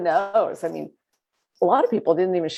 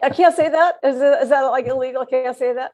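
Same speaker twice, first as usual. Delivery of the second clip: laughing; 7 semitones higher than usual. Real, not acted. Laughing at own risky joke.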